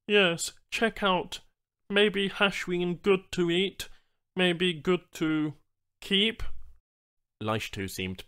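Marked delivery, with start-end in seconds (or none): 0.1-6.4 high, slow alien voice; patient alien explaining slowly; slow, pitched-up alien guide; alien Banu trader. Acted.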